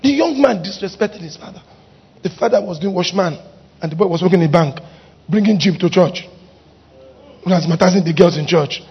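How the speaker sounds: normal talking voice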